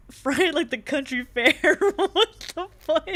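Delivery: loud, slow laughing through the words; cracking up mid-sentence; laughing hard. Real, not acted. Cracking up at a joke.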